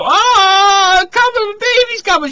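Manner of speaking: high-pitched, loud mock cry of a woman in labor; retelling a pregnant woman crying out in the buggy, hammed-up and high. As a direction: squeal it like she's about to give birth. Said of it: "and the girls go".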